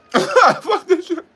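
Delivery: high, slow laugh that runs into the curse; a real bitter laugh over the swear. Real, not acted. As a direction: laugh it off in disbelief. Laughing at own bad luck.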